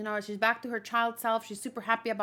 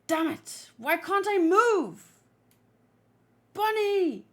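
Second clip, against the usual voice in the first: high, loud cry of frustration; acted desperate shout; 8 semitones higher, 6 dB louder, slower than usual. Trapped fighter calling to Bonnie, desperate shout. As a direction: strain it out, stretching the words.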